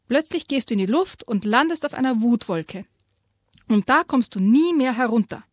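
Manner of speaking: a stern dad raising his voice; stern warning. A narrator's reading. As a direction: scold the child firmly. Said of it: "die Stimme lauter".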